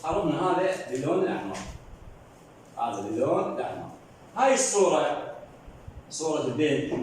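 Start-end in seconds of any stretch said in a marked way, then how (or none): none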